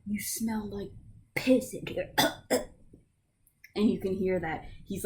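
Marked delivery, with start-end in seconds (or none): 0.1-0.9 whispered through a gag; gagging. Acted. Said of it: "already gagging". 1.3-2.7 gagging. Acted. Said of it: "already gagging".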